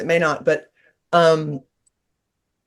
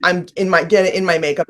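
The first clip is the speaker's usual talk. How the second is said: normal talking voice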